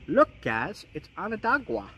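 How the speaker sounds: a hint of Long Island, playing up his old Onondaga; fond mock Long Island accent, looking back. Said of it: "how I used to say it with my Long Island accent".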